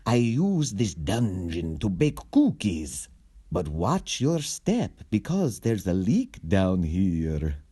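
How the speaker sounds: slow, lightly voiced villain; a hint of a polite villain; kindly villain. Villain explains his dungeon.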